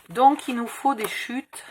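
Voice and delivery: usual speaking voice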